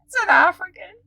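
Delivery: so excited. Retold or imitated. Learning the African doctor speaks French, so excited. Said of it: "like, so excited".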